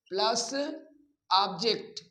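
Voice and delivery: ordinary delivery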